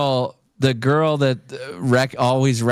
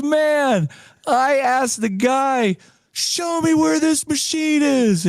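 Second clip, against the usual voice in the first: very high, drawn-out comic urgency; excited imitation; slower than usual. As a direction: imitate a frantic friend, slow and high-pitched. Imitating breathless friend running in.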